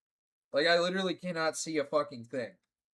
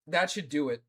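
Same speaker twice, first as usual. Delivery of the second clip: normal talking voice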